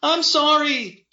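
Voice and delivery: very high, loud, drawn-out plea; crying out. A narrator's reading. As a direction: wail an apology while under attack. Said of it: "Freddie cried".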